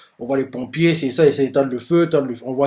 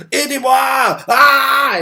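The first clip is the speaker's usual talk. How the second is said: much higher, loud panicked yell; shout for help; 11 semitones higher, 5 dB louder, much slower than usual. Acted. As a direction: scream to be rescued. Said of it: "Il crie".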